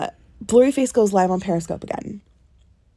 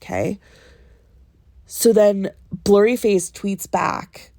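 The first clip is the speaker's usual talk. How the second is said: ordinary talk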